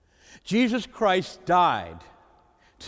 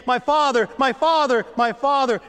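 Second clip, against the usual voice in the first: calling on the Father; 5 semitones higher than usual. Acted. Jesus voiced calling on the Father.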